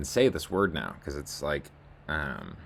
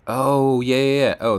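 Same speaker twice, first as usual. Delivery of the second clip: much higher and louder sudden yell of recognition; excited realization; 10 semitones higher, 13 dB louder than usual. Real, not acted. Vlogger remembering a thread's context, excited shout. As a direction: burst out as it finally clicks.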